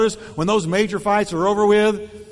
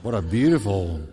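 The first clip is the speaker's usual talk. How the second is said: much lower, dull and grudging; sarcastic compliment; 10 semitones lower, much slower than usual. Shown as a demonstration. Pastor demonstrates a sarcastic compliment after a sigh. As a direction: say it like you don't mean it.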